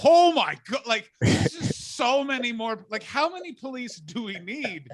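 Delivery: high and loud, shocked; hammed-up alarm at the police; mock alarm. Seeing riot cops fill the street, loud high alarm. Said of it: "And you're like".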